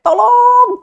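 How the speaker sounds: very high, loud scream of tolong; panicked scream for help. Acted. Young man refusing to let go and screaming, high loud scream. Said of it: "mulailah dia teriak".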